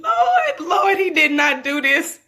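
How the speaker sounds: scandalised delight, calling out; shocked exclamation. Retold or imitated. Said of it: "I said".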